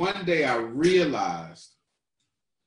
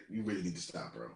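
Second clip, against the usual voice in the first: inner voice; 6 semitones lower, 7 dB quieter, faster than usual. Retold or imitated. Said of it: "little voice in my head that kept saying".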